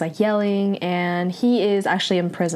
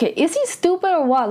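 high-pitched, incredulous outburst; exasperated anger; 8 semitones higher than usual. Retold or imitated. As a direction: relive your anger at a foolish character. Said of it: "I was very angry when I saw this".

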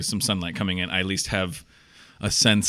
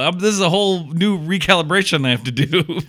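raised, louder mock panic over the late hour; 7 semitones higher, 4 dB louder than usual. Host to co-host, a raised, louder mock panic over oversleeping. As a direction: act out waking up late and panicking about the lost day. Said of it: "I'm like".

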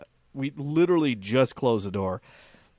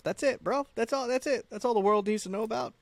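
high-pitched protest; defensive insistence; 11 semitones higher than usual. Real, not acted. Man defending his short list of partners, high insistent protest. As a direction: insist on it in a much higher voice.

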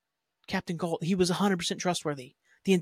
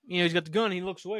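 normal talking voice

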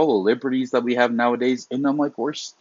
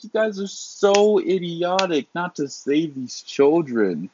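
higher, slower, incredulous scolding; disgusted tirade, pitched up; exasperated scolding; 9 semitones higher, slower than usual. Retold or imitated. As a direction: berate them for failing the kids. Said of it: "I'm like".

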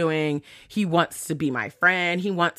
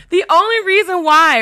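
louder and much higher, fed up; exasperated outburst; 11 semitones higher, 7 dB louder than usual. Real, not acted. Ranting to viewers about the scammer, loud exasperated outburst. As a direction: burst out in disbelief at the scammer.

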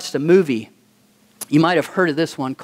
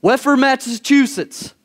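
retold scream; 6 semitones higher than usual. Exhausted team's player stands up before his coach, retold scream. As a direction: imitate a kid crying out, held back from a scream. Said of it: "he just screams out".

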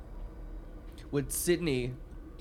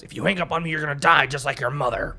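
loud, a bit higher threatening quote; mock threat; 4 semitones higher, 8 dB louder than usual. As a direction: snarl the threat. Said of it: "And then he goes".

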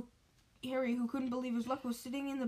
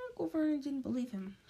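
ordinary delivery

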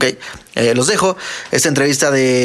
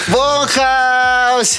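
high, ringing name call; hyped guest introduction; guest announcement; 11 semitones higher than usual. Acted. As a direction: announce the guest like a big reveal.

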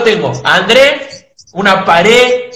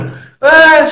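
a short retold roar from the stands, pitched up; crowd roar; 8 semitones higher than usual. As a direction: belt it out like the whole stand is roaring. Hinchada rival gritándole en el partido. (grito de tribuna imitado)

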